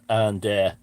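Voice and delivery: ordinary delivery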